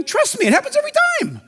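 tossed off high and fast; sudden high aside. Real, not acted.

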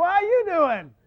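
high, loud playful greeting; booming mock reply. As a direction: answer the kid with matching exuberance. Retelling his booming reply to boy.

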